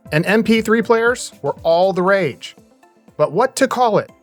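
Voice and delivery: crisp, punchy podcast intro read; scripted intro narration. Acted. Host telling listeners how podcasting got its name, scripted narration. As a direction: narrate it like a documentary opener with lifted questions.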